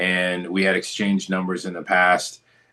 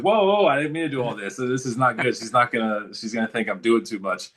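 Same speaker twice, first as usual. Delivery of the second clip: higher-pitched, rushed alarm easing off; mild mock panic; 4 semitones higher, faster than usual. Retelling his emoji mishap to the interviewer, mildly panicked. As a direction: play up a flustered "whoa, hold on" reaction.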